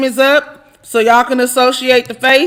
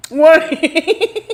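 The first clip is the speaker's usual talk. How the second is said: laughing wait; 4 semitones higher than usual. Real, not acted. Laughing repeat.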